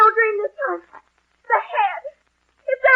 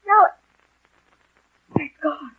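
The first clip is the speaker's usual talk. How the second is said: ordinary delivery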